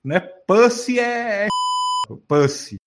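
"Pussy" said as "pâssi", set apart; halting, insistent demo of "Pussy" with an "â" vowel; correcting pronunciation. Shown as a demonstration. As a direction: model "Pussy" carefully for a learner. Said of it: "você tá pronunciando errado".

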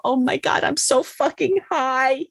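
high-pitched, drawn-out giddy exclamation; mock giddy realization. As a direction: imitate yourself drugged and amazed by it. Acts out being high in car.

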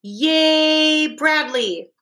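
high, drawn-out cheer. A narrator's reading. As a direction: cheer like a whole crowd of fans. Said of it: "They all cheered".

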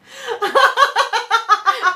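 big burst of laughter; loud laugh. Real, not acted.

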